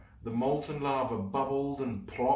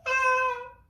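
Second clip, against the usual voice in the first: plain reading voice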